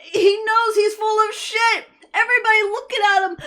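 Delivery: high, loud gleeful squeal; giddy high-pitched commentary; excited squeal. Real, not acted. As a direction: squeal with delight at the courtroom. Excited reaction to courtroom laughter.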